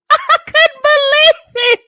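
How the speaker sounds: real high, loud, slow squeal through laughter; cracking up. Real, not acted. Storyteller who couldn't believe the cashier, squealing with laughter. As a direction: squeal it out, helpless with amusement.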